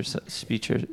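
breathy whispered word speecher. Shown as a demonstration. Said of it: "with a dialect like that".

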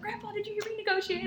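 very high-pitched excited kid imitation; super excited. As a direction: squeak it out like a thrilled child. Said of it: "I was, like, super excited".

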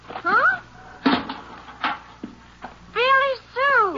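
a sharp high "Huh?" then a slow, stunned "Billy Sue"; jolted into recognizing her sister; startled recognition. Acted. Girl recognizes long-lost sister.

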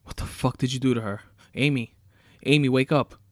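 angry demand rising into a full yell. A narrator's reading. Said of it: "He yelled".

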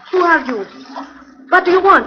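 high, loud scared questions; frightened demand. Acted. Countess demands who the hidden voice is, frightened and loud. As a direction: cry out at the unseen stranger.

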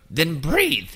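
a hint of an old lady, firm; mild old woman instruction. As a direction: tell him to breathe like a stern old lady. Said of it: "that's my old woman voice".

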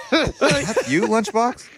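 high amused voice; laughing in disbelief; surprised, laughing recognition. Retold or imitated. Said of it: "voicing a celebrity".

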